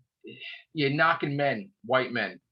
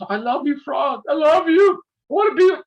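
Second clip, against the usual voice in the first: high, mock-swooning lover's voice, building louder; mocking the lovestruck Doctor; 10 semitones higher, 4 dB louder than usual. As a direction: ham up a gushing declaration of love. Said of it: "The doctor's making love to him".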